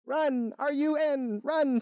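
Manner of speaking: very high-pitched alarmed bee; mock urgent cry. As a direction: call out a high warning, spelling it out. Bee urges fleeing, after turtle.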